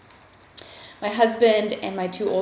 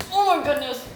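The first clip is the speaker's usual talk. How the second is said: high cooing dog-talk; 7 semitones higher, faster than usual. Real, not acted. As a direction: coo at the puppy. Greeting the puppy, high voice.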